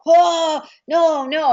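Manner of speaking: exasperated shout at the missed kick; angry outburst. Retold or imitated. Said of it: "he's getting angry".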